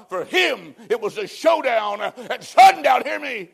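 high, drawn-out pulpit shout; full-force preaching. Real, not acted. Preacher warning the congregation of a showdown at sundown, full-force shout. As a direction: cry it out as an urgent warning.

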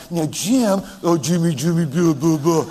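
mock baby-talk cooing to a husband; Nanette. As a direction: babble pet names in a silly coo. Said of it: "Can you imagine Nanette?".

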